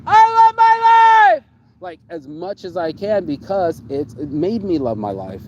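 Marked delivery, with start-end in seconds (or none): 0.1-1.4 joyful scream at full volume, high and drawn out. Retold or imitated. Shouting his joy to the sky. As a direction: belt it out like you're on top of the world. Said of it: "And I screamed".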